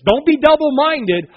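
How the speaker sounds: preacher booming a warning at the congregation; loud preaching. Real, not acted. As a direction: thunder it at the crowd.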